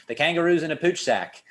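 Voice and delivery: put-on a clumsy, laughing stab at an Australian accent; bad Australian accent. Imagining Australians talking about kangaroos, a bad Australian accent. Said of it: "my bad attempt at an Australian accent".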